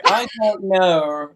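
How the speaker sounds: imitated accent, long KNOW with an R tail; drawn-out R-ended know. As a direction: drag out KNOW until an R creeps onto the end. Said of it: "almost like a R on the end".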